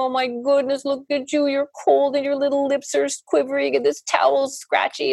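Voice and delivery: high, cooing sympathy; sing-song tender fussing; empathetic baby talk. Acted. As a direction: commiserate with an uncomfortable baby in a high voice. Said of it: "You look at the baby and you go".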